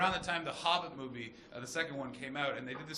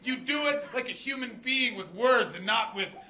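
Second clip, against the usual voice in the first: mock outrage; 3 semitones higher, 8 dB louder than usual. Wronged lover scolding a married woman, hammed-up indignation. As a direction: rebuke grandly, hammering it out loudly.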